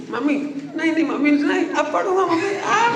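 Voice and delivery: hammed-up scared child; panicked child pleading. Child begs the shouting mother, mock panicked.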